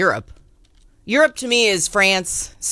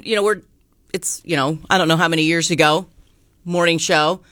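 ordinary talk

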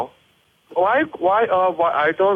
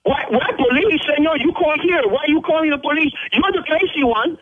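high, heated outburst at the caller; angry shouting; 7 semitones higher than usual. Real, not acted. Restaurant owner yelling at the prank caller, angry and high. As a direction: yell back, outraged and indignant.

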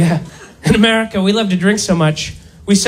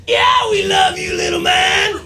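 much higher mock-shouted pat on the head; condescending cheer; 9 semitones higher than usual. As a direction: patronise him like a big brash buddy. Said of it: "We're like".

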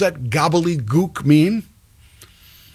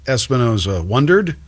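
ordinary talk, nothing marked

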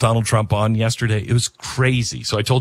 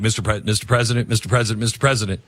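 rushed wrap-up; faster than usual. Retold or imitated. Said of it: "And I'm like".

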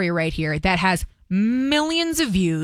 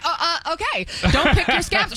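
higher, quoting her through laughter; laughing mid-quote; 6 semitones higher than usual. Retold or imitated. Host retelling the interviewer's flustered reply, laughing. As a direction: giggle your way through the reply. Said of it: "she's like".

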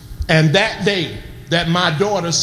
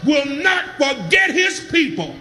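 raised, ringing preacher's cry over the organ; full-force preaching; 10 semitones higher, slower than usual. Acted. Preaching, shouting over music.

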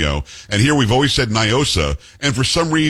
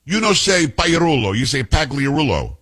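imitated Italian-accented correction; thick Italian accent; 3 semitones higher than usual. Italian woman correcting his surname, thick Italian accent. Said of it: "a thick, um, Italian accent".

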